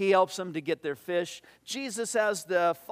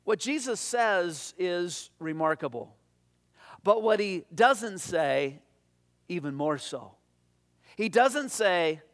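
ordinary talk

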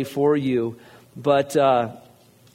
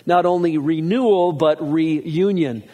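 reunion drawn out very slowly after a louder renewal; stress on union; 3 semitones higher, 4 dB louder, slower than usual. Shown as a demonstration. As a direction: lean hard and slow on reunion. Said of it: "Emphasis on the word union".

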